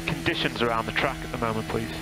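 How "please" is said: usual speaking voice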